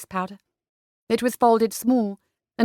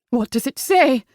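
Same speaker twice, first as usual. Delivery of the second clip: roused; 3 semitones higher, 4 dB louder than usual. A narrator's reading. Roused mother demands news.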